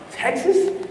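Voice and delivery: "Texas?" jumps up high in an excited question; excited disbelief. Retold or imitated. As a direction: sound thrilled and amazed at "Texas?". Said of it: "I get excited".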